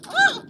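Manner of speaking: short sharp startled scream; sudden scream. Real, not acted. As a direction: let out a quick scream. Sudden scream mid-chat.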